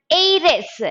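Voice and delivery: "heiress" said as "ay-ress", no H; silent H. Shown as a demonstration. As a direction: start "heiress" on a long A. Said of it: "It is a".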